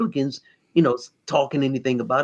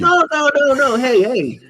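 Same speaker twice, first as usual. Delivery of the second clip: loud protest; 12 semitones higher, 7 dB louder than usual. Real, not acted. Loud protest in group banter.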